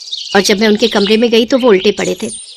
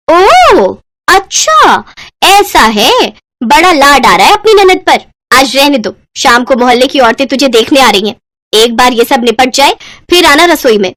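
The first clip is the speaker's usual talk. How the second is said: sarcastic taunt; 4 semitones higher, 4 dB louder than usual. Acted. Babita mocks Sakshi sarcastically.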